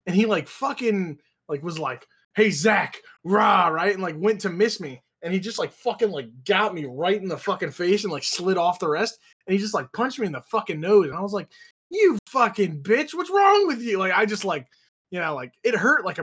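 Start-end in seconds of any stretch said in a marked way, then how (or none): 2.3-3.7 loud playful fake threat; playful roar, put on for the retelling; mock play-fight shout. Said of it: "he's, like, play fighting". 11.9-14.0 high-pitched, slow indignant outburst; mock-outraged, voice leaping high; retold anger. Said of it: "then I was just mad".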